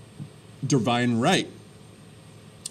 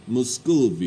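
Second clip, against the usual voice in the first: Russian accent. Shown as a demonstration. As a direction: give Muscovy a hammed-up Russian accent. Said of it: "You have to say it with Russian accent".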